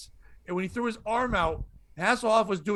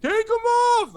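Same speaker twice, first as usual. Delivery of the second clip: mock dopey yell; 5 dB louder, faster than usual.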